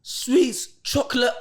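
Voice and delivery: each item called out high and loud; excited list. Real, not acted. To friends about the hidden cupboard snacks, a high excited list. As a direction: reel off the treasure you found.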